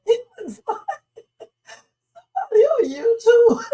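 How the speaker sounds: squeaking the words out high through tears of laughter; can't hold it together; real crying-laughing, pitch way up; laughing hard. Real, not acted. Cracking up at news clip.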